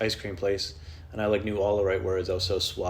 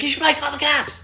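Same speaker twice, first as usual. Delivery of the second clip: high-voiced roommate; 6 dB louder than usual.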